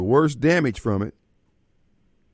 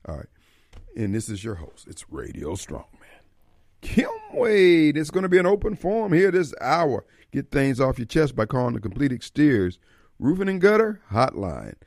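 radio show opening. Acted. Host welcomes listeners back to the open forum, radio show opening. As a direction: stretch the key words like a showman opening up.

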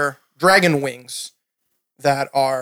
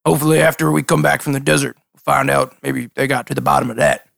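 lightly voiced dim fisherman; a hint of a cheerful simple NPC; Toko voice; 2 semitones lower than usual.

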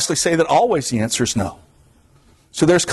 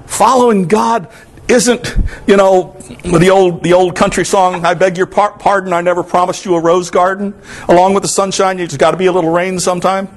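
Sunday preacher lifting his voice to the congregation; impassioned preaching; 4 semitones higher than usual. Acted. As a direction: preach it with rising fervor. Preaching, pitch raised for many lines.

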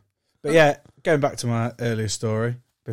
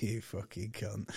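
muttered curse; 3 semitones lower, 15 dB quieter, slower than usual. Retold or imitated. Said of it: "I was like".

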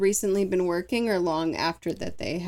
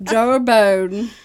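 put-on New Zealand accent; 10 dB louder than usual. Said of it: "that sounded legit New Zealand accent".

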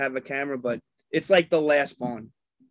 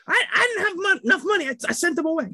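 mock someone pleading in a much higher pitch; high-voiced excuse; 12 semitones higher, faster than usual. Retelling Ernie's excuse to hungry friends, mock high voice. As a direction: imitate a high, squeaky excuse.

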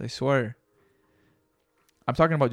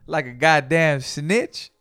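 movie rent-a-cop quote; 4 semitones higher, 7 dB louder, slower than usual. Retold or imitated. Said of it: "when the rent-a-cops and he comes out".

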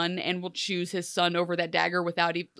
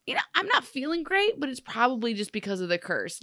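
higher, airy brush-off; nonchalant; 4 semitones higher than usual. Retold or imitated. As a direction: shrug it off lightly as Neil. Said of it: "So he's just like".

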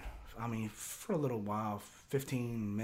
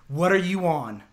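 loud, raised demand; angry retelling; 6 semitones higher, 12 dB louder than usual. Retold or imitated. Buddy confronting him about being high, angry. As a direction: act out a buddy's sharp challenge.

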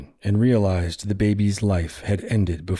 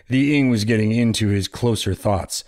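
usual speaking voice

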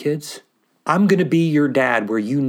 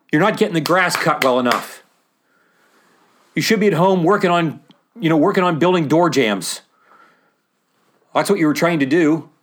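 mild exasperated scolding; 3 semitones higher, 4 dB louder than usual. Acted. Family scolding Jesus for neglecting his trade, mild exasperation. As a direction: lightly play a fed-up relative calling him home.

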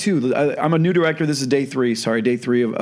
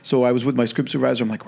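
ordinary talk

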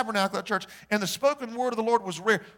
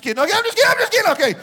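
laughing it off; 12 semitones higher, 10 dB louder, much faster than usual. Real, not acted. Backpedals after joke on northerners.

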